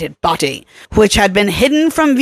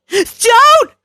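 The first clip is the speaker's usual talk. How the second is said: sharp cry; 4 dB louder than usual. A narrator's reading. Kelly pushing Troy away.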